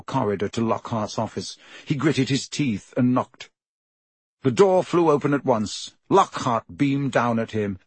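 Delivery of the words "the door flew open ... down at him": ordinary delivery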